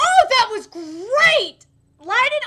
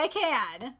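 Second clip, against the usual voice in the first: ordinary talk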